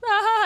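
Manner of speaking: mock wail on waking, high and loud; startled cry. Describing waking up crying, a mock startled yelp. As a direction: jolt awake with a yelp. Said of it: "wake up crying 'cause you're like".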